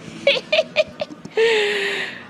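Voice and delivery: high, helpless burst of laughter; cracking up in real delight. Real, not acted. Laughing at Brown still running.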